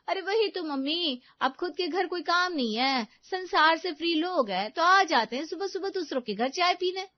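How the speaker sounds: high-pitched griping to mom; pointed daughter-in-law rant on a call; loud phone talk. A narrator's reading. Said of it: "जोर-जोर से बातें करने लगती है".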